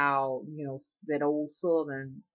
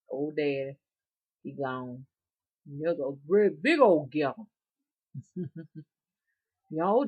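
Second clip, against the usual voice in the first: normal talking voice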